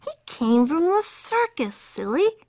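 little sister voice. A narrator's reading. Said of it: "said Jilly Boo".

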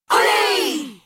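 high cheering shout; crowd cheer. A narrator's reading. Circus crowd cheering the stunt, excited. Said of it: "shouted the crowd".

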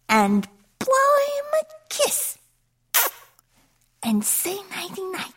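very high voice, softening at the end; cooing goddess. A narrator's reading. Goddesses preparing the cat for sleep.

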